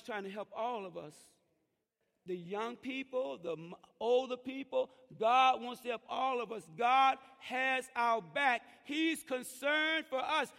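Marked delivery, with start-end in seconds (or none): 2.3-10.5 loud, high preaching voice, raised at the church; preaching at full force. Acted. Pastor preaching loud to the church about parents. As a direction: preach it out to the congregation.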